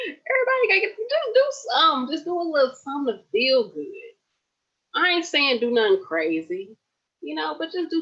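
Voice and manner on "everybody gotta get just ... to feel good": high, breaking voice close to tears; voice cracking. Real, not acted. Urging her live viewers to feel good, voice breaking. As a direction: let the voice crack up high as feeling spills over.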